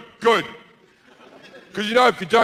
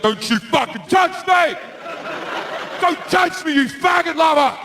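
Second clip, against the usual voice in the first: much higher, shouted divine fury; furious God; 11 semitones higher than usual. Acted. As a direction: scream at them not to touch.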